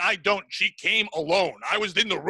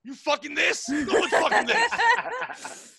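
laughing; 10 semitones higher, slower than usual. Real, not acted. Mocking his own scary look.

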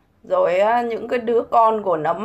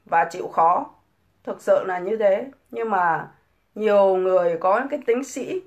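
ordinary talk

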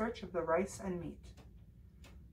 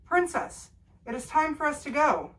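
high, loud call from outside; shouting; 7 semitones higher, 7 dB louder than usual. A narrator's reading. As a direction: call out loudly through the door. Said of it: "the prince shouted from outside".

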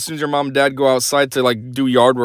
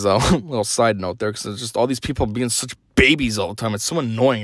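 ordinary delivery

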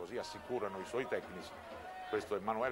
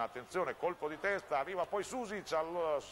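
excited; 8 semitones higher than usual. Real, not acted. Goalmouth scramble in live commentary.

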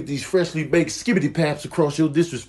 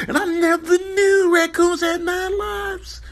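mock high voice; slower than usual. Odd high voice.

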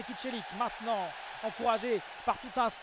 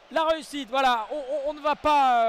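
excited; 4 semitones higher, 9 dB louder than usual. Real, not acted. Celebrating lead after goal.

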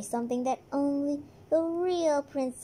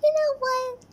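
excited; 11 semitones higher, 8 dB louder than usual. Acted. Loud high announcement.

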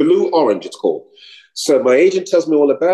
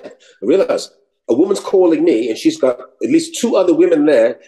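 ordinary talk, nothing marked

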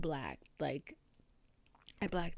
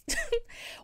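sharp high laugh; high breathy laugh. Real, not acted. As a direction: burst out laughing. Laugh mid-rant.

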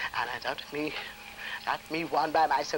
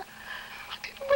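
whisper; 13 dB quieter than usual. Real, not acted. Aside while waiting.

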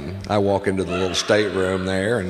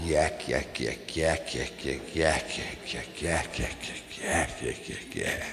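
fading yak yak; 8 semitones lower, 11 dB quieter, slower than usual. Retold or imitated. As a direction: chatter that fades to a whisper. Mimicking endless chatter, fading.

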